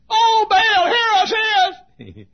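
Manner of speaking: very high, loud drawn-out wail; mock calling out. Prophets of Baal begging for fire, mock wailing call. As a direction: wail to a god who isn't listening, hammed up. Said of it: "prophets of Baal were calling down".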